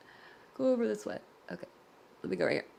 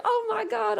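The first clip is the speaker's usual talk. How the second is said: mock surprise; 11 semitones higher than usual. Reacting to the twist.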